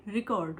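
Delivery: "रिकॉर्ड" with an Indian English accent; Indian English pronunciation. Shown as a demonstration. Mother demonstrating for an accent challenge, Indian English pronunciation. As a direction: pronounce "रिकॉर्ड" as ri-KORD, Indian style. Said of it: "India में हम ऐसे बोलते थे".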